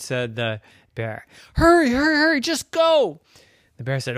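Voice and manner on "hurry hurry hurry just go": high, loud frantic urging; urgent bird voice. Acted. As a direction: push them to hurry. Bird urges.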